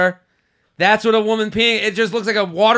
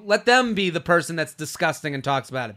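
normal talking voice

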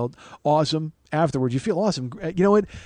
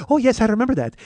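high, loud impression of an excited waxer; [name]; 9 semitones higher, 7 dB louder than usual. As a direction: exclaim it in a put-on high voice. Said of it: "did an impression of you".

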